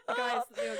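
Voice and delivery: high laughing protest. Real, not acted. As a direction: laugh the words out. Laughing after emotional quote.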